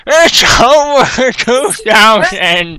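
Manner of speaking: very slow, high mock yell; exaggerated, drawn-out shout; catchphrase shout. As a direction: drag out each word in a hammed-up holler. Repeats the parodied shouted catchphrase.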